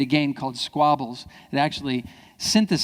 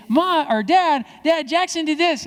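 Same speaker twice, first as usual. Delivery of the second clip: put-on high, loud tattling child; a kid running to dad to tattle, high and whiny; 14 semitones higher, 6 dB louder than usual. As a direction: whine it like a kid telling on his brother.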